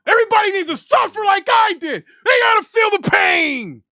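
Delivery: hammed-up anguished shout. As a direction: wail it so everyone suffers too. Raging over Cowboys loss.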